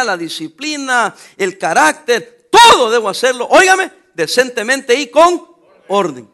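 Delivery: sermón a pleno pulmón, más alto; predicación fervorosa. Acted. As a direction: predica con fuerza a la congregación. Preaching at full force. (predicando con fuerza)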